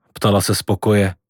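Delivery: ordinary delivery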